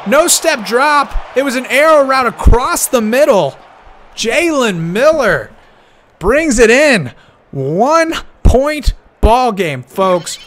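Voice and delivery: announcer still buzzing, breaking down the touchdown; excited recap. Real, not acted. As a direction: savor each word of the replay. Replay recap after touchdown.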